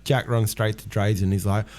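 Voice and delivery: normal talking voice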